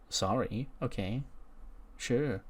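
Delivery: soft, hesitant acceptance; subdued apology. Acted. Fallon backing off after being rebuffed, small and subdued. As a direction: back off quietly, word by word.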